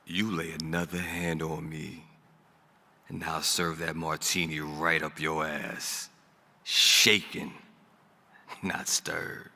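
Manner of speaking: low, soft, menacing calm; gravitas. Acted. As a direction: drop the laugh and threaten quietly. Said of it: "a little bit more of that gravitas".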